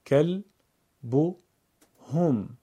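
کل، بو، هم as three separate syllables; syllable-by-syllable reading. Shown as a demonstration. Teacher breaks up a word for learners: کل، بو، هم spaced out. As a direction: pause after each part: کل، بو، هم. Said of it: "بخش خوانی کنید".

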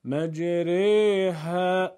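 مجریها chanted slowly, the alef bent toward a long "ee/eh"; imaleh pronunciation. Shown as a demonstration. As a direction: draw out the middle vowel of مجریها as a long "reh". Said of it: "اماله یعنی میل الف به سمت یا".

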